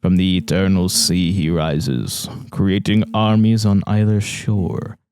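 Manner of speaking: slow, solemn reading of a prophecy poem; measured, grave recital. Acted. Friend reads the ominous prophecy poem aloud, slow and solemn.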